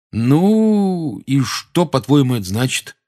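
lightly voiced schoolboy; a hint of a teenage boy. A narrator's reading. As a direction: skeptical teen question. Ron voiced higher.

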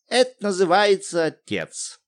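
патетическое восклицание; pathetic exclamation. Acted. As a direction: make a show of her outrage. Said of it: "патетически воскликнула".

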